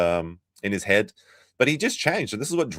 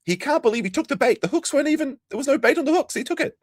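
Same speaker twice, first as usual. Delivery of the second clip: mocking disbelief. Mocking Nick's gullibility.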